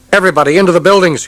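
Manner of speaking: high, loud fast shout. Acted. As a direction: yell the order over gunfire. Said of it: "Krishnan shouted".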